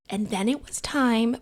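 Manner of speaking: ordinary talk, nothing marked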